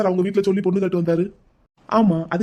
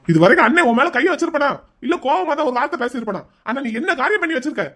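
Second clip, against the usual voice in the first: higher-pitched, wounded reproach; angry scolding; 5 semitones higher than usual. Acted. Brother confronting his sister over her secret, angry scolding. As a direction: scold her as the brother who always cherished her. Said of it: "கோவப்படாதீங்க அண்ணே".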